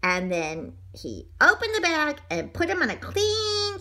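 usual speaking voice

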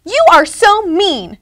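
high, loud, drawn-out outburst; storybook shriek. A narrator's reading. Ruby to Pete who squirted her, high loud shriek. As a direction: shriek it slowly, leaning on each word. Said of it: "she shrieked at him".

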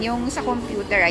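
normal talking voice

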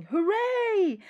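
high, loud cheer; shouting. Acted. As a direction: cheer out joyfully. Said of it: "villagers shouting".

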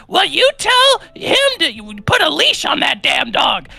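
hammed-up high old woman voice; cranky old woman. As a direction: shriek it like an angry old neighbor. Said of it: "and she goes".

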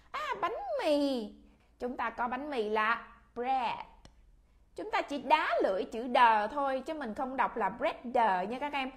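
usual speaking voice

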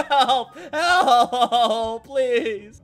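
loud laughing shout for help; mock panic, laughing; laughing cry for help. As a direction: yell for help while cracking up.

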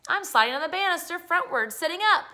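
put-on high, loud boyish holler; excited yell. Boy on the banister yells at Connie, excited showing off. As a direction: call out the stunt proudly. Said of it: "yelled at Connie".

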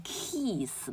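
kiss mispronounced as "keess" with a long ee; long vowel mistake. Shown as a demonstration. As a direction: stretch kiss into a long, tense ee. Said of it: "习惯性念成kiss的".

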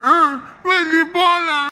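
put-on slow, whimpering little-child voice; slow, high mock-cry of a toddler; voicing a crying toddler. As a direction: play the fussy baby begging to go out. Adult voices the crying baby.

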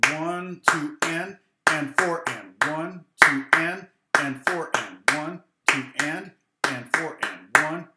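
loud, punchy chanted count over the clapping; loud rhythm count. Shown as a demonstration. Teacher leads the clapping, chanting the rhythm loudly. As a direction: chant the count so the class can clap along. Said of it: "we can clap it".

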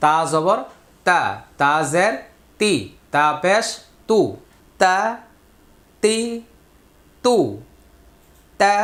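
recitation drill. Shown as a demonstration. Teacher leading pupils through Quran letter sounds, loud drill voice.

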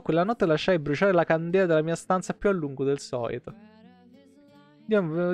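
usual speaking voice

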